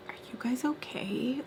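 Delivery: hushed, whispery question; soft condescending mimicry; imitated mock concern. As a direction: ask softly as if they were crazy. Imitating her condescending look.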